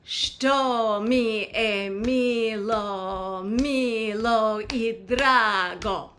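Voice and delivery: slow, drawn-out syllables in song rhythm; spoken in rhythm. Shown as a demonstration. Teacher saying lyrics for learners to repeat, rhythmic speech. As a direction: speak the lyric slowly to the beat, unsung. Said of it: "I'll say it in the rhythm of the song".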